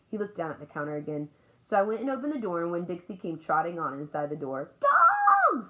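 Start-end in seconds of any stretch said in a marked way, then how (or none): 4.8-5.7 very loud, high parrot shriek; one long piercing squawk; parrot scream. A narrator's reading. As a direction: screech it like an alarmed bird. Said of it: "screamed Gertrude".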